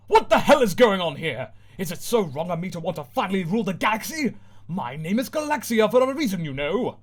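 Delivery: loud fed-up outburst; exasperated rant. Acted. Villain fed up with the chaos, ranting. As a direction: vent your frustration.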